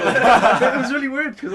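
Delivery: very loud and high, through laughter; laughing. Real, not acted. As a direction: burst out laughing as you talk. Laughing at own odd story.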